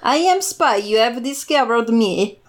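put-on vaguely European spy accent, lightly done; hammed-up European spy voice; mock spy accent. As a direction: play the exposed spy with a hint of a European accent. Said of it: "have the accent of the place".

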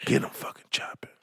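hushed whisper, sneaky; whispered order. Retold or imitated. Coach whispering to the team behind the mom's back. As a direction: whisper the curse so the parent won't hear. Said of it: "I said".

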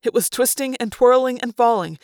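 plain reading voice

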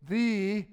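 single out "the" with a long thee; stress on "the". Shown as a demonstration. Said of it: "Everybody say the".

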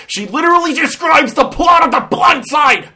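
high, loud outraged yell; exasperated shout. Real, not acted. Reviewer ranting about a blatant film reference, exasperated shout. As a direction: burst out in disbelief, shouting.

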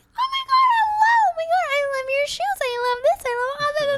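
mock an overeager suck-up; gushing kiss-ass. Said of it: "You're like".

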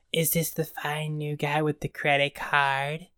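slow, drawn-out put-on lady voice; slow character-actress impersonation; Jennifer Tilly impression. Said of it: "my Jennifer Tilly impression".

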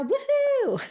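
high squealing cheer; cheerleader. Pretend. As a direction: whoop like a cheerleader. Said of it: "Do I sound like a cheerleader".

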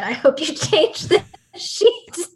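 amused retelling, cracking up mid-line; laughing quote. Retold or imitated. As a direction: relish the zinger while laughing. Retells the zinger, breaking into laughter.

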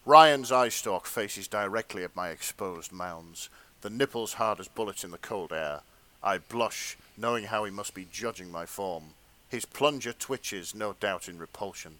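low, measured story-reading voice; hushed erotica narrator; fanfic narration. Acted. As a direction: read it like a sensual audiobook, played straight.

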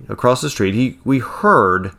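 usual speaking voice

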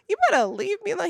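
high, fast, petulant snap; hammed-up petulance; mock snippy warning. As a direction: brush the doctor off in a hurry. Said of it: "I'm just like".

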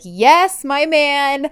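high, loud, drawn-out approval; triumphant cheer. Retold or imitated. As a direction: cheer him on like a delighted fan. Viewer cheering the retold rejection.

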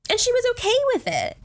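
high, fast disbelief; can't believe it, pitch way up; incredulous. Real, not acted. Incredulous rant about characters.

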